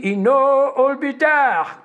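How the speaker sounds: put-on slow drawled gringo accent; mock American-accented Spanish. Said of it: "que habla así".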